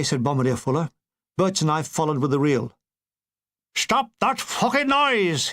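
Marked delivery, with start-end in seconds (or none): 3.7-5.5 a mild Irish hiss, high and slow; angry Irish hiss. Acted. Irish soldier hushing the noisy linemen, Irish accent. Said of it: "hissed an angry Irish voice".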